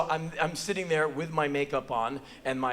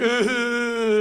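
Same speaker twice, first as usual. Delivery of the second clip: high, loud fake boo-hoo; mock bawling; 7 semitones higher, 6 dB louder than usual. Man mocks boy's actress sister, exaggerated crying noise. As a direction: imitate a crybaby's wail. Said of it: "Do you hear me going".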